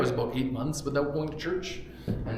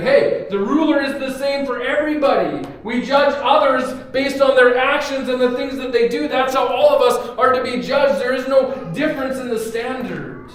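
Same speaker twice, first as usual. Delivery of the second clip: voicing Jesus; 8 semitones higher, 8 dB louder than usual. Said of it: "And Jesus is saying".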